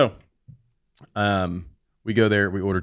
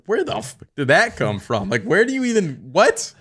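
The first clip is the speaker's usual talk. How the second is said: high, loud disbelieving yelp; incredulous outburst; 10 semitones higher, 9 dB louder than usual. Retold or imitated. Husband baffled by wife's sudden shepherd's pie craving. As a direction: sound stunned and baffled.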